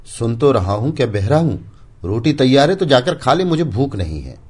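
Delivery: high, loud irritated comeback; loud, fed-up husband refusing food; irritable retort. Acted. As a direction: snap back that you heard her. Husband snapping back irritably.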